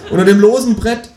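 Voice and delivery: high, loud confession; desperate scream. Acted. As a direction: blurt it out in a frantic high shout. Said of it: "schrei ich verzweifelt".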